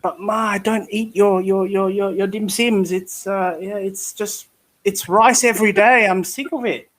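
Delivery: scene: son complains to Ma about rice every day, lightly whining. Acted. Acts child pleading with mother.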